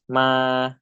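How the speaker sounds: ma and ka said on a level mid tone, steady pitch; mid tone demo. Shown as a demonstration. Teacher models mid tone syllables for students, level and steady. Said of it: "default tone is the first one of the spectrum".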